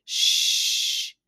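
long, hushing "shh"; cautioning shush. A narrator's reading. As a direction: shush them firmly. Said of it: "he cautioned".

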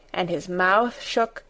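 usual speaking voice

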